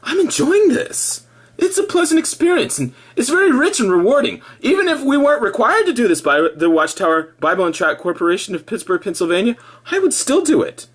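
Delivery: high, bright mock-believer voice; mock cheerful witness. Mocking a Witness's forced cheer, high sing-song. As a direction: parody a smiling Witness, pitched well up. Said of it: "supposed to tell themselves".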